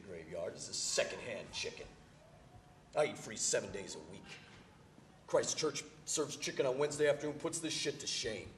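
normal talking voice

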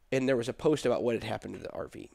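ordinary talk

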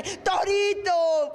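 long drawn-out, high mock cry; melodramatic wail. Mimicking old film heroines, hammed-up drawn-out cry. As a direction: wail the name out, stretched and high.

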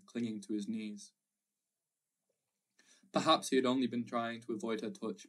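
ordinary delivery, nothing marked

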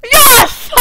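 very high, loud yes; triumphant shout. Real, not acted. Player boasting of mastering the game, triumphant shout. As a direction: shout it in victory.

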